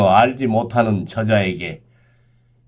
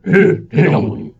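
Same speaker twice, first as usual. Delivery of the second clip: soldier's reply; 6 semitones higher, 4 dB louder than usual. Acted. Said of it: "병졸이 대답했다".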